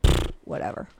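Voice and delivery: low scornful pfft, brushing it off; scoffing dismissal. Retold or imitated. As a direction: scoff like it means nothing. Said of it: "I would have went".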